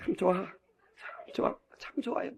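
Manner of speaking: near-whisper, slow and very soft; hushed savouring. Real, not acted. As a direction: let it sink to an appreciative whisper. Savouring the psalm just recited.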